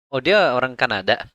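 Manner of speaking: ordinary talk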